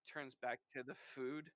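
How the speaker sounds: ordinary delivery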